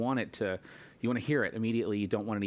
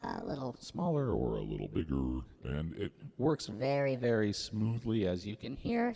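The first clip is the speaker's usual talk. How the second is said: lowered voice through a pitch shifter; pitch-shifted voice; 3 dB quieter than usual. Shown as a demonstration. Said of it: "I can also adjust pitch and Format".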